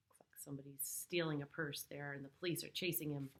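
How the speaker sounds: plain reading voice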